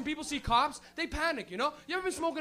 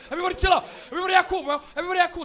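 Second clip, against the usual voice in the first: louder, higher, panicky yell of chill out; panicked shout to stay calm; 4 semitones higher, 7 dB louder, much faster than usual. Retold or imitated. Stoner spotting cops yelling at the car to act cool, panicked. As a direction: shout at everyone to act cool while losing it. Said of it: "He'll be like".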